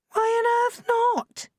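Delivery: mother can't believe she refused money; astonished. Acted. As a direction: stretch it out in disbelief.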